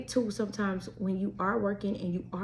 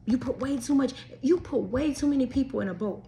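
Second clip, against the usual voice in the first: ordinary delivery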